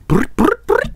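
high trilled brr noises; short rolled-lip bursts, pitched high; mock sound effect. Imitates a pipe sound effect.

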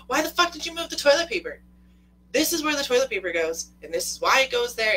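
high, loud outraged rant; mock losing it. Wife confronting husband over moved toilet paper, mock outrage. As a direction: imitate someone flipping out, loud and high. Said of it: "would lose your shit".

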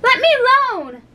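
high-pitched indignant demand; cross, high little outburst. A narrator's reading. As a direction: push them away with a high protest. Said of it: "said Alice indignantly".

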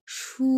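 সু as "shu", held high and level; long, even high-pitched সু; first tone. Shown as a demonstration. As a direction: sustain সু on one high pitch. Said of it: "এক number tone".

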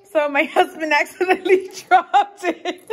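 laughing so as not to cry; laughing through it. Real, not acted. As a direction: laugh while telling the mishap. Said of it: "I'm gonna laugh so I don't cry".

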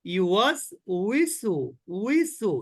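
"উইসু" said slowly with a w glide; slow model reading. Shown as a demonstration. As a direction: read it slow for students. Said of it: "practice করেন". (ধীরে ধীরে উচ্চারণ)

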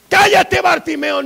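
high, loud rebuke; scolding shout. Acted. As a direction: shout him into silence. Crowd hushes Bartimeo a second time.

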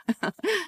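short high amused laugh; a brief bright chuckle; light laugh. Acted. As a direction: laugh lightly, half-knowing.

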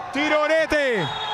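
high, slow drawn-out goal shout; breathless italian goal commentary. Real, not acted. Goal scored, drawn-out call. (urlo del gol lungo e acuto)

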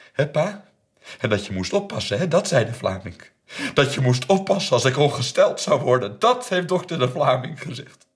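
high, loud, taunting attack; shouted accusation. Retold or imitated. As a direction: hurl it at your father, building. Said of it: "schreeuwde ze". (luid geschreeuwde beschuldiging)

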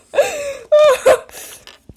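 loud, high burst of laughter; high shrieking laugh. Real, not acted. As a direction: cackle out loud, high and shrill. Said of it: "Czego ty się tak rżysz". (wysoki, piskliwy wybuch śmiechu)

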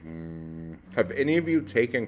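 speaking between bites; chewing while talking. Real, not acted. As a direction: mouth partly full of cracker. Seen 1: Bites cracker at -2 to -1, talks while still chewing, holds rest.

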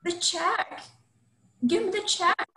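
high, urgent panicked cry. Acted. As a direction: grab for it in a panic. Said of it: "Ellie is panicked".

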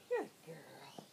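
whispered praise; whispering. Real, not acted. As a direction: breathe it without voice.

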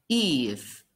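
usual speaking voice

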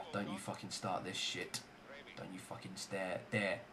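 very soft, low grumble; muttered warning. Real, not acted. Gamer warning game not to lag, muttered warning. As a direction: warn the game under your breath.

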